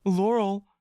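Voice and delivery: put-on a scared child calling his friend; frightened boy. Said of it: "you say".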